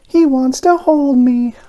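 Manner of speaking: very high, slow, dreamy little voice; high donut voice, slow and content. A narrator's reading. Arnie resting in Mister Bing's hand, slow and content. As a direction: savour it, eyes closed.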